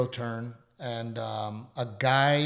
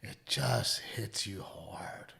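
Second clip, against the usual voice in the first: slow, softer, barely voiced; real emotion catching the breath; moved whisper; 4 semitones lower, 9 dB quieter, much slower than usual. Real, not acted. Telling the co-host how it hit him, slow whisper.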